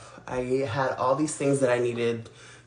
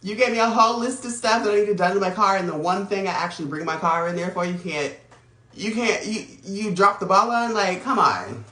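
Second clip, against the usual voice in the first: raised, exasperated complaint; frustrated; 5 semitones higher, 3 dB louder than usual. Real, not acted. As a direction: let the frustration build. Complaining about the dropped ball.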